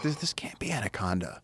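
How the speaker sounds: hushed whisper of disbelief; mock whisper, trying not to be heard; retold whisper. Answering his friend in the dark, whispering.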